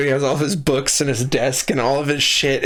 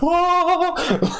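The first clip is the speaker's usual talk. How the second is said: high laughing whoosh. Retold or imitated. Jesus jumps back into sky.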